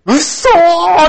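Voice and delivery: high, loud delighted disbelief; surprised. Retold or imitated. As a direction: squeal it in surprise. (驚いて叫ぶ)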